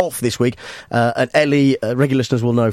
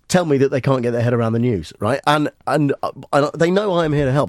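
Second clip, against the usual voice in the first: ordinary talk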